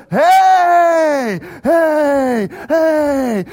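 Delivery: loud, high, long hollers; mean it now, bellow each one; all-out cheer. Retold or imitated. Whole section now really doing it, all-out cheer. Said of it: "from kind of this feigned and pity".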